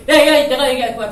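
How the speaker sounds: loud, high call for attention; eager shout. Acted. Peter shouting to the disciples, loud eager call. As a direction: burst in loudly to claim the floor.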